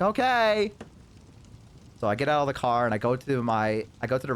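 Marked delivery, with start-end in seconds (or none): 0.0-0.7 high, drawn-out okay; overly agreeable, rising voice; uneasy fake cheer. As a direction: humour her with a pitched-up okay. Uneasy reply to her goodbye.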